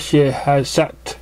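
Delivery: usual speaking voice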